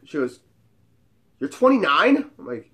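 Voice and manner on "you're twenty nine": high, rising surprise; imitated disbelief. Hot friend learning his age, imitated surprise. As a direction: echo the age incredulously.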